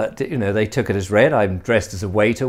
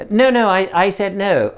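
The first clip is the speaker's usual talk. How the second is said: irritated refusal; 8 semitones higher, 4 dB louder than usual. Retold or imitated. Guest annoyed at forced refill.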